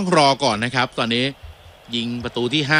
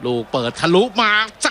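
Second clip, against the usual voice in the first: excited call; 8 semitones higher than usual. Real, not acted. Live dangerous cross in box.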